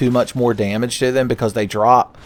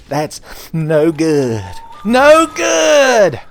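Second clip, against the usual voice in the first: hammed-up cartoon wail building to a high loud "No good!"; fake-sob it, slow then burst out; mock sobbing; 7 semitones higher, 6 dB louder, much slower than usual. Sonic crying over Tails in hospital, mock wail. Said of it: "sob under his breath over and over".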